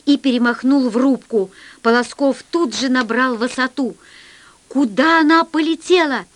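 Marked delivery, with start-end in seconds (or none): 4.7-6.3 higher-pitched, raised-voice question; loud question. A narrator's reading. Pilot asking over the engine's hum, loud urgent question. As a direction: ask it over engine noise. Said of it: "спросил он громко".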